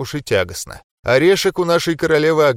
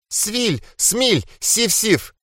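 whistling voice; 9 semitones higher, much slower than usual. Acted. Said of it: "превращал её слова в неразборчивый свист".

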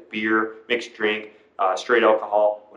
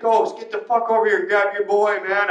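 high urgent call for help; 7 semitones higher than usual. Retold or imitated. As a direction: yell across the room. Friend calling for help.

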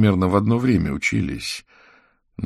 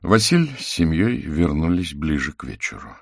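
ordinary delivery

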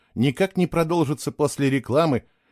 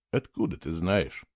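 gentle question; 6 semitones lower, 6 dB quieter than usual. A narrator's reading. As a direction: lower and softer, tender. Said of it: "мягко спросил он".

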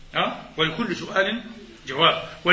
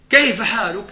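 loud inquiry; 5 semitones higher, 6 dB louder, slower than usual. Acted. Deaf visitor calls to sick man.

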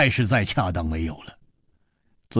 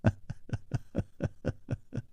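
soft, drawn-out, breathy laughing; voiceless prolonged chuckle; long laugh; 3 semitones higher, 13 dB quieter than usual. A narrator's reading. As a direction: laugh on and on lightly. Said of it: "李玉涵长笑道".

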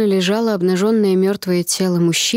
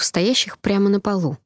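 ordinary delivery

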